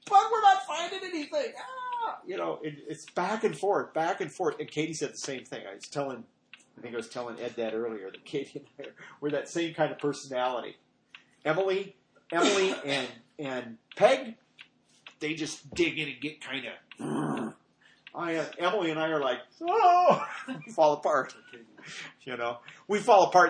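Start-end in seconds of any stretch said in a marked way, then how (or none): 0.1-2.2 high, loud frustrated outcry; a sharp high Ah of frustration; exasperated cry. Retold or imitated. Retold prayer in frustration. 19.6-20.3 high, loud dramatic Oh; a loud high wail of overwhelm; mock distress cry. Falling apart, mock outburst.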